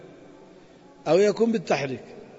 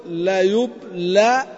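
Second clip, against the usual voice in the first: slow drawn-out demo; much slower than usual. Shown as a demonstration. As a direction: stretch out يبلى slowly, rising at the end. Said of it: "إذن نقول". (نطق بطيء ممدود للكلمة يبلى)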